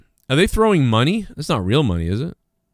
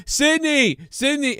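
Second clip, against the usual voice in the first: high, mock calling of her name; pushy reporter; 13 semitones higher, slower than usual. Reporter interrupting the grieving girlfriend, high mock call. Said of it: "he's like".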